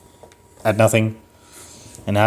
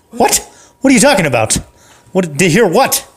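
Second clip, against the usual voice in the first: higher, louder disbelief; can't believe what he hears; incredulous guard; 7 semitones higher, 3 dB louder than usual. Acted. Guard hears Gary will kill him, pitched up in disbelief.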